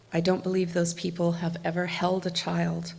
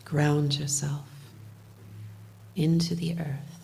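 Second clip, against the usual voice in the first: guided meditation; 4 dB quieter than usual. Acted. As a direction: lead them gently into calm, half whispered. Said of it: "do a little bit of guided meditation".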